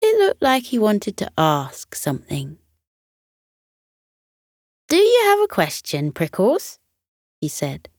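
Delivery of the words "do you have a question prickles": eager question. Acted. Coco asks Prickles kindly.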